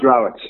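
"Rowett" as RAU-et, with OW as in cow; correct surname pronunciation. Shown as a demonstration. Guest telling host his surname, OW sound in "Rowett". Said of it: "It's pronounced".

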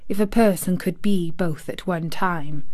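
ordinary delivery

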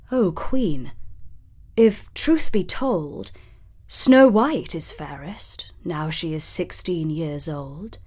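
hushed, solemn mirror voice; shimmering echoing mirror voice. A narrator's reading. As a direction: whisper the prophecy like a mirror. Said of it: "the mirror said".

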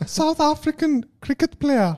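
high, slow mock South African accent; exaggerated South African impersonation; bad South African accent. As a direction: draw it out in squeaky South African. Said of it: "Remove this accent from the list".

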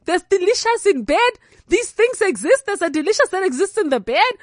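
high, amazed questions; astonished wonder. Retold or imitated. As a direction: gasp it like a naive kid. Teen amazed by new idea.

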